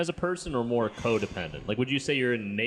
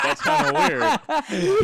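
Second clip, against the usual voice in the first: drawn out puzzled; 10 semitones higher, 6 dB louder, much slower than usual. Retold or imitated. Voicing his own reaction.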